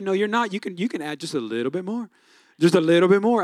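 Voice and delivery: low, soft coaxing whisper of temptation; tempting voice of culture. Pretend.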